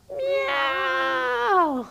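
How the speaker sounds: high, drawn-out cat meow. Shown as a demonstration. Teacher meowing like a cat for a child in cat-cow. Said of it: "Really loud".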